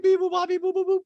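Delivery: high bleeping sounds; mock censor bleep. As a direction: imitate a TV bleep. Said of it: "censor bleep imitated".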